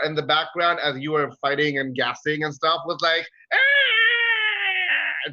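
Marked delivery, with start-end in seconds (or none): 3.5-5.3 high startled yelp of fear; frightened cry. Acted. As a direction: cry out in terror, cowering. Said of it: "just, like, cowering in one corner".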